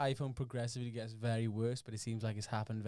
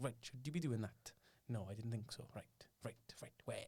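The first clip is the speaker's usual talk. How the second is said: soft Welsh teacher accent, dropping to unvoiced; talking down to kids in a Welsh accent; patronizing Welsh accent; 8 dB quieter than usual. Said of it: "patronizing Welsh accent".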